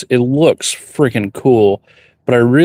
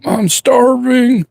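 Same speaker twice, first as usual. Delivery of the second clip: whiny starving voice; 12 semitones higher, much slower than usual. Retold or imitated. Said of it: "I'll be like".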